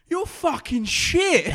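slow, loud, high football-terrace yell; retold abusive shout. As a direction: bellow the insult like a rowdy fan. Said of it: "And he went".

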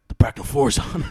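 mock-grave comic-book reveal; Rorschach. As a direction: announce the menace like a masked avenger.

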